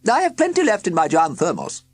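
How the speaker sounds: high, loud cheery woman's voice; a bright chatty countrywoman; woman in overalls. A narrator's reading. Woman at the tent announcing the car problem, high and loud.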